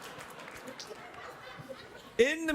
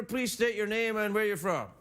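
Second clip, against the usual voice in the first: ordinary talk, nothing marked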